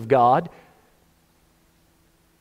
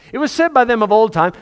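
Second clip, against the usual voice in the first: preaching quote; 7 semitones higher, 4 dB louder, faster than usual. Acted. Repeats the quote faster.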